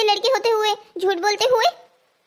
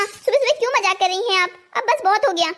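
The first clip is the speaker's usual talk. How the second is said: ordinary talk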